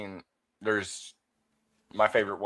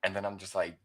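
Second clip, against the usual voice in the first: usual speaking voice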